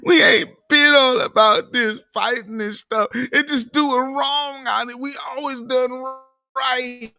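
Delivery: high, loud, whiny plea to Reverend Al; mock pleading caller. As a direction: ham up a caller begging for sympathy.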